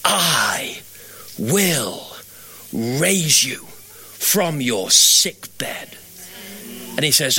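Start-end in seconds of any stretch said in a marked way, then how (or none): 0.0-6.0 low, word by word with long pauses; really slowly. Retold or imitated. Verse returning to a fading patient, "I will raise you" very slow and low. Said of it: "but really slowly".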